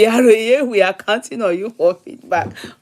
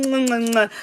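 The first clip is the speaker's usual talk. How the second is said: blown kisses; 4 semitones higher than usual. Real, not acted. Blowing kisses to listeners.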